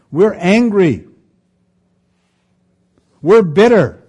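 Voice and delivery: mock angry complaint; acting angry. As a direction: voice the grudge with heat.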